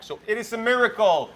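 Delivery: loud, high-pitched exclamation; excited. Acted. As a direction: announce it like big news. Joking about voice coming back.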